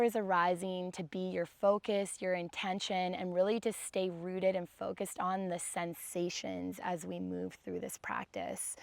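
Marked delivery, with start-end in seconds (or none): none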